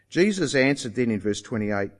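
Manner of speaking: ordinary talk, nothing marked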